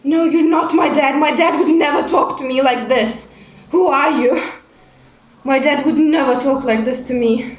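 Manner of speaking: loud denial. Acted. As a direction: shout him down, he's not your dad. Player rejects the fake father.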